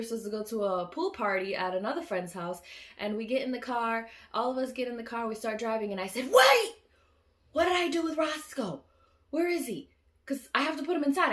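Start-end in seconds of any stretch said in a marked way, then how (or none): 6.3-11.3 panicked yell. Retold or imitated. Retold panic at forgetting dog.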